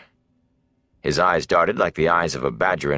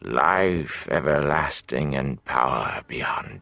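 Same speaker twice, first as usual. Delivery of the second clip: slow, breathless whisper of greed; hungry whisper; 4 semitones lower, 4 dB quieter, much slower than usual. A narrator's reading. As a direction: breathe it with craving. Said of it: "thick with hunger for that power".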